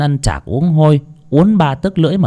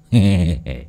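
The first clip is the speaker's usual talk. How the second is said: pleased laugh; 4 semitones lower than usual. A narrator's reading. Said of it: "sướng quá".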